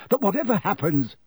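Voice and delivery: high-pitched, pressing urgency; urgent, hurried. A narrator's reading. Man extracting a promise before departure, hurried. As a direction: rush to get the promise out. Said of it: "talking more quickly still".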